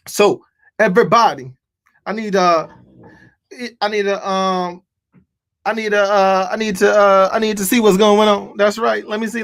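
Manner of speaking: ordinary talk, nothing marked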